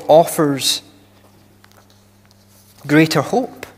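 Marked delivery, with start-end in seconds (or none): none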